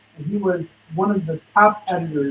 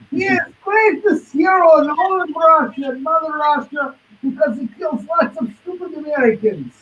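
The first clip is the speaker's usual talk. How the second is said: hammed-up Russian accent, pitched higher; mock Russian accent; 10 semitones higher, 4 dB louder than usual. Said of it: "I'll duck back on my Russian accent".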